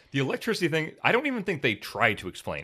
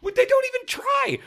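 very high, indignant complaint; exasperated; 4 dB louder than usual. Real, not acted. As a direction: throw it out in squealing exasperation. Exasperated rant about lazy writing.

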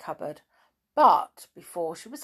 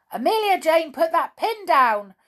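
high, loud scared cry; frightened shout; 11 semitones higher, 9 dB louder than usual. Acted. Teddy bear shouting at Amelia Jane in fright. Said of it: "shouted the teddy bear in fright".

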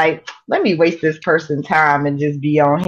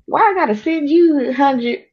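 higher, mock-disbelieving question; incredulous retort; 8 semitones higher than usual. Retelling her reply to the scammer, higher and incredulous. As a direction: ask it like it's absurd. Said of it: "I'm like".

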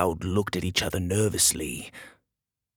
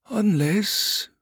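higher, tentative, left hanging; hesitant; 11 semitones higher than usual. Acted. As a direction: reluctantly float an idea. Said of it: "the other wizard said hesitantly".

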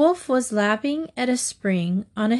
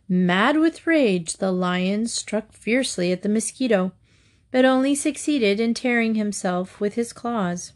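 usual speaking voice

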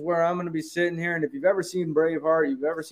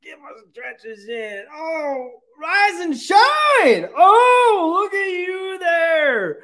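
loud, high, slow groaning stretch; overacted morning wake-up cries; hammed-up waking groan; 13 semitones higher, 3 dB louder, much slower than usual. Hype host groaning awake before the fire-up speech.

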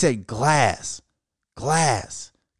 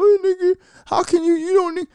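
very high, loud mock scold; mocking angry women; 3 dB louder than usual. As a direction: shriek it like offended cousins.

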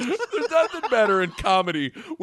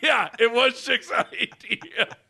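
laughing; 6 semitones higher, slower than usual. Retold or imitated. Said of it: "I go".

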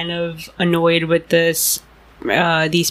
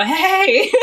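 high, drawn-out mock holler; retold shout; 10 semitones higher, 4 dB louder than usual. Woman retelling how she hailed running girls from her window, mock shout. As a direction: imitate calling out of a window, half laughing. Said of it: "shouted out of my window".

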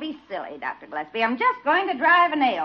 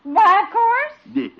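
slow, higher, offended; indignant; 7 semitones higher, 5 dB louder, much slower than usual. Acted. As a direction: bristle at the question. Proud of her painting.

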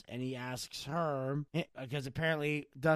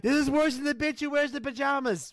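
high, loud mocking voice of a ranting friend; a hammed-up put-on voice of an annoyed friend; imitating a friend; 10 semitones higher, 5 dB louder than usual. Host imitating his friend complaining on the phone, high and mocking. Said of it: "He's like".

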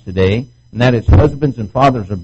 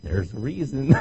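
ordinary talk

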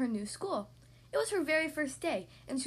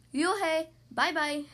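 louder teasing singsong farewell; yelling goodbye; 4 semitones higher, 5 dB louder, slower than usual. Acted. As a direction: call it out after her as she leaves. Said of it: "the kids yelled as she left".

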